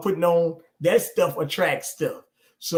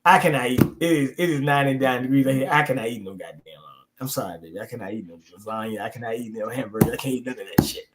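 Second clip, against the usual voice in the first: mock pleading refusal; 6 semitones lower than usual. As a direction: beg off the food, half-crying. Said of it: "I said".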